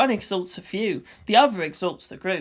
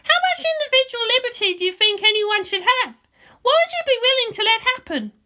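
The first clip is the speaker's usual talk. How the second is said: put-on higher, louder girl's voice for Asuka; a young girl arguing her point; Asuka's voice; 3 dB louder than usual. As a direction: raise the voice to play the headstrong girl.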